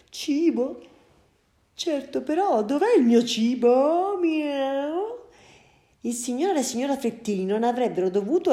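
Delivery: puzzled cat thinking aloud, mewing; a cat realizing breakfast is missing; hungry cat. Acted. (gatto affamato che pensa ad alta voce)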